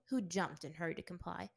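ordinary delivery, nothing marked

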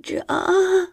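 high mewing yes; mewed. A narrator's reading. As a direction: mew it high and small. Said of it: "I mewed".

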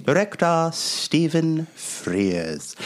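slow, drawn-out title read; slow announcement. Real, not acted. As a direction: read the credit slowly and grandly. Announces the director slowly.

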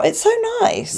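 squeaky high mimic of a gushing fan; high mock gush. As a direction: coo it like an overexcited fan. Mimicking fans at photos.

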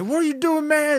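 very high, comic complaint; mock indignant protest. Lead singer starting an argument with the dad, mock indignation. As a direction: object like an affronted bandleader.

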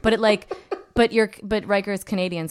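ordinary talk

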